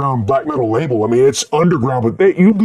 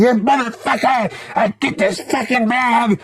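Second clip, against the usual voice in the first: high, far New Jersey cussing; New Jersey accent; 10 semitones higher, 4 dB quieter than usual. Retold or imitated. Cemetery worker cursing at the band's van, New Jersey accent. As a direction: imitate a grumpy New Jersey worker swearing. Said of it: "New Jersey accent".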